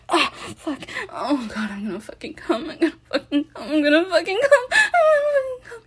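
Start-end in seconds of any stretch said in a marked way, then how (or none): 1.7-5.8 building to climax. Acted. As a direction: whisper rising to a high breaking cry.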